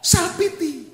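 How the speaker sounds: mocking label. Real, not acted. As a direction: pop it out high like a punchline. Names the fault, mocking it.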